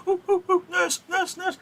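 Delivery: very high, eager little Juliet squeals; excited rush. Acted. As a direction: rush up calling for the nurse. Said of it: "she's gonna rush along".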